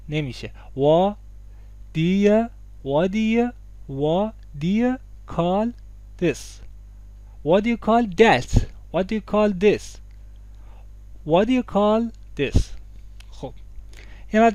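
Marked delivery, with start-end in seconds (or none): 0.7-6.6 "What do you" in beats, "wa... di-ya", no T; بدون ت. Shown as a demonstration. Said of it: "تی تلفظ اصلاً". 7.4-12.6 higher-pitched "waddiya call", no T in "What"; بدون ت. Shown as a demonstration. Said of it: "تی تلفظ اصلاً".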